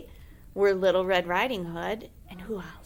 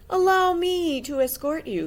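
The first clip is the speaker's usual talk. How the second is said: higher, louder mock-gallant wolf; 8 semitones higher, 7 dB louder than usual. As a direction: offer your arm like a polite wolf. The wolf speaks.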